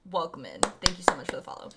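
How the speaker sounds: acting out a soft welcome; whispery, low thank-you to a follower; hushed streamer greeting. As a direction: murmur it quickly under your breath. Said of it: "I go".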